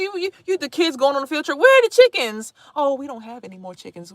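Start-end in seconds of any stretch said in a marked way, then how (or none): none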